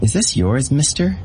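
put-on sweet voice of a girl holding out a severed head; sweetly. A narrator's reading. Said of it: "and sweetly said".

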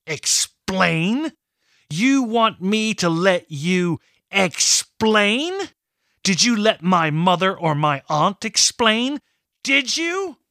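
rising, outraged challenge, slow at first; angry demand. Acted. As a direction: hurl the questions at him in fury. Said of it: "she demanded".

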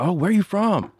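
imitated curious stranger. Older couple asking a musician in an elevator, imitated curiosity.

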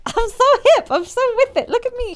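a hint of a proud old granny; grandma voice. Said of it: "one of those grandmas that's like".

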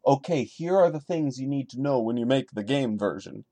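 ordinary delivery

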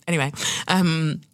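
usual speaking voice

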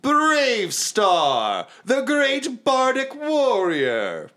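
high, drawn-out self-announcement; Brave Star. Acted. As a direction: proclaim your own stage name grandly. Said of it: "And he's like".

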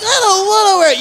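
put-on high, loud kid's protest; protesting son. Comic plays young Rudolph refusing his father, high loud protest. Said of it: "And he's like".

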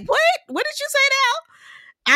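very high, drawn-out disbelief; taken aback. Retold or imitated. Speaker reacting to sister quoting the show, incredulous. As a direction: re-enact your own incredulous double take. Acting out her startled reaction.

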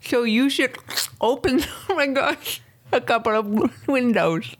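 high, slurred mock denture speech; falling-out dentures voice. Co-host asked for an accent, loose-dentures voice. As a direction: talk as if your false teeth are slipping. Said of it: "make it sound like you have dentures".

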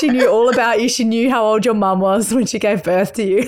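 lightly voiced eager mum, Aussie twang; mum's Australian accent. Host imitating her mum bragging about the guest, lightly Australian. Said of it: "do her accent".

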